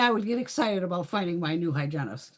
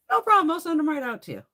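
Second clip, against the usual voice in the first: high, bright-toned receptionist voice, much louder; chipper receptionist; 11 semitones higher, 6 dB louder, faster than usual. Retold or imitated. Retelling the union clerk on the phone, chirpy high voice. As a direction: play the eager phone receptionist.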